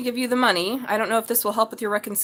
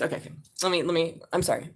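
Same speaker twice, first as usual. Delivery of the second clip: ordinary talk, nothing marked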